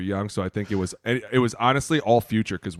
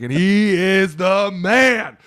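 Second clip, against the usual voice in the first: slow, higher, louder emcee voice; grand announcement; 8 semitones higher, 6 dB louder, much slower than usual. Retold or imitated. Player introducing Phil at the dinner, slow grand announcing. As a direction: stretch it out like a big introduction.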